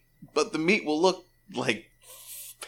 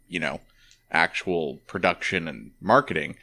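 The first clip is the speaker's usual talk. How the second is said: podcast intro; 7 semitones lower than usual. Acted.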